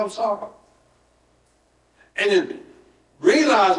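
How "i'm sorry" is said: simple apology. Shown as a demonstration. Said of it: "Just go".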